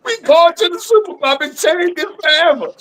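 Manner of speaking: fired up, pitch jumping high; all-in hype about his team; real excited outburst, high and fast. Real, not acted. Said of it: "All right, [name], calm down".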